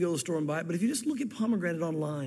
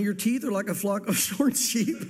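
reading through giggles; laughter breaking into the words; trying not to laugh. Real, not acted. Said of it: "I'm trying not to laugh".

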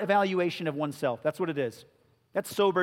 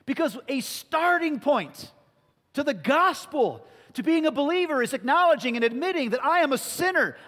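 raised, intense preaching in a higher voice; impassioned preaching; 5 semitones higher than usual. Acted. Preacher to the congregation, in full-force preaching. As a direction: press the confession home with force.

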